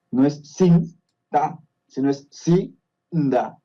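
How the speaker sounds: "sin, da" then "si, da", the break moved before the N; slow contrast between "sin da" and "si da"; split into syllables. Shown as a demonstration. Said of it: "Si lo ves escrito así". (separar "sin-da" y luego "si-da" con pausa)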